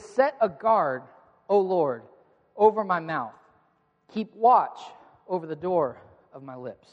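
measured psalm recitation; solemn scripture reading. Shown as a demonstration. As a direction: read it aloud with the congregation. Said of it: "we read it together".

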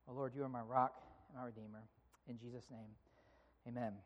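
low, soft praying voice; prayer. Real, not acted. Pastor asks God to speak to hearts, quiet prayer. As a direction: pray aloud, hushed and low.

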